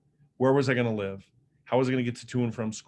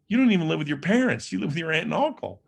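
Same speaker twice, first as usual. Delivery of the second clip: higher-pitched, disbelieving objection; acted protest; 5 semitones higher, 4 dB louder, faster than usual. Teen kicked out answers his friend's offer, protesting.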